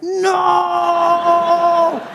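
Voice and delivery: high startled yell of no; panicked shout. Retold or imitated. Facing an oncoming car, a high panicked shout. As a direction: shriek it as the car comes at you.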